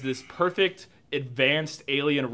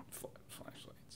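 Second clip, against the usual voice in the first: voiceless whisper trailing to almost nothing; hushed disbelief; 29 dB quieter, slower than usual. Real, not acted. Critic on super advanced aliens' tools, whispered disbelief. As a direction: let the punchline shrink to a whisper. Deadpan punchline on absurd detail.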